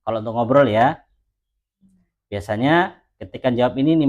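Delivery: normal talking voice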